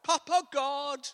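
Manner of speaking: high, drawn-out imitated prayer call; imitated fervent cry, stretched out; man crying out in prayer. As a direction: call out slowly and loudly to God. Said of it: "a man from Sierra Leone just goes".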